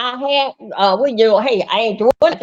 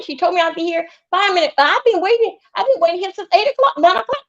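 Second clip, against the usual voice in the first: raised pitch, mock complaining woman; imitating Teresa; 7 semitones higher than usual. A friend quoting Teresa's whining complaint. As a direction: voice Teresa pleading her case.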